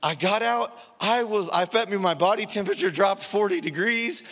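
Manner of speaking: ordinary talk, nothing marked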